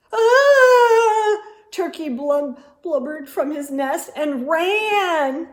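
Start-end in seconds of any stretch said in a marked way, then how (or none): 0.1-1.4 loud high startled squawk; startled cry. A narrator's reading. Turkey startled by the moose, frightened cry.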